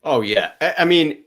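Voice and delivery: normal talking voice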